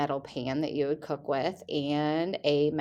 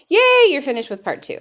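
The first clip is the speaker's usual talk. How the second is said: higher and louder bright cheer; excited cheer; 8 semitones higher, 10 dB louder than usual. Real, not acted. Teacher congratulating students on finishing part two, excited cheer. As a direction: celebrate them finishing the section.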